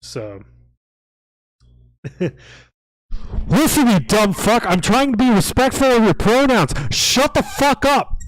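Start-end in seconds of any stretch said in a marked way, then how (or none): 3.5-8.2 loud high furious yell; angry shouted quote. Acted. Viewer quote read out as a furious loud yell. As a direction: scream it like you've lost all patience. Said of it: "says Top Hat Socialist".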